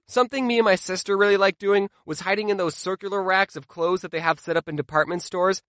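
usual speaking voice

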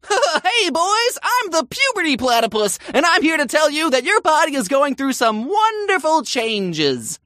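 high, far-off hammed-up cartoon mascot voice; a platypus mascot chirping to schoolboys, hammed up; cheesy health-video host. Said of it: "The ones that are like".

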